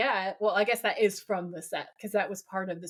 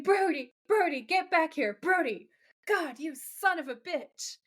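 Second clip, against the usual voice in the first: mock scream; 6 semitones higher than usual. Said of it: "Wendy's screaming".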